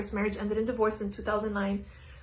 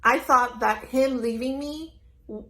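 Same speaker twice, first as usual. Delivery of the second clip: usual speaking voice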